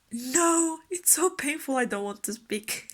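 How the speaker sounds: high outburst settling into laughter; mock pained protest. Labouring wife refusing her husband, laughing mock-pained protest. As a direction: whine in pretend pain, breaking into a laugh. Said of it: "And I was".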